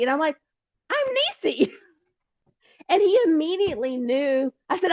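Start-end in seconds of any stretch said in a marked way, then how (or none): 0.9-1.7 high, bright name reveal; spring your name as a happy surprise; delighted reveal. Retold or imitated. Telling the podcast guest who she is, a high delighted reveal.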